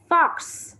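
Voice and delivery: put-on teacher models "Fox." in English for young pupils; model English word. Shown as a demonstration. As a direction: sound out "Fox." high and clear like a teacher. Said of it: "ví dụ như là con cáo đọc là fox".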